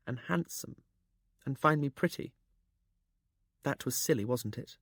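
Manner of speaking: shy. A narrator's reading. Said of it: "admitted shyly".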